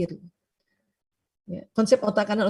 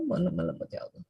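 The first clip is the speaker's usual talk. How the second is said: hushed, faster murmur to God; soft quick prayer; 4 semitones lower, 9 dB quieter, faster than usual. Shown as a demonstration. Preacher shows parents a soft prayer for gentleness. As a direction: pray it under your breath, quickly. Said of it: "Makanya minta".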